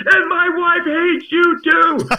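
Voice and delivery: high, slow, loud delighted yell; gleeful hatred. Retold or imitated. Old fan adding his wife's verdict to the villain actor, gleeful loud yell. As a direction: crow it joyfully as the imitated fan. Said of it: "the joy with his eyes and his smile".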